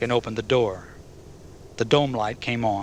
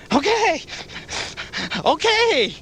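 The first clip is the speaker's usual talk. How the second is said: very high, loud panicked screech; screeching biker; 3 dB louder, slower than usual. A narrator's reading. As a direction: screech in terror. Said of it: "screeched the biker".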